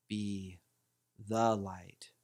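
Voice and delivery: low mantra with "the" held and weighted; stress on THE. Shown as a demonstration. Teacher shows flowing mantra emphasis, stress on "the". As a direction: pause after "Be", then lean on "the". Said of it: "then the and then the light".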